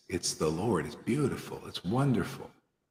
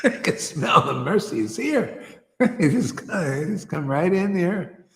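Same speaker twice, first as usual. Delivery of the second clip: amused, talking through laughter at a higher pitch; laughing; 6 semitones higher than usual. Real, not acted. Preacher tickled by newcomers sniffing out mercy, laughing. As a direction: let real laughter break into the words.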